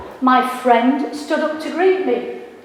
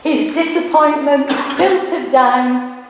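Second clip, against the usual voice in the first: ordinary talk, nothing marked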